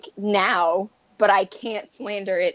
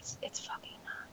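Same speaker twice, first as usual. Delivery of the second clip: hushed under-breath denial; whispering; 22 dB quieter than usual. Real, not acted. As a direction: whisper it to yourself.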